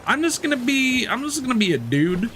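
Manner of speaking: lifted, goofy high voice for the thought; mock-cheerful high-pitched resolve; high mock inner voice. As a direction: voice the plan in a silly high register. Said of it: "and I'm like".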